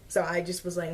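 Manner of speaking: ordinary talk, nothing marked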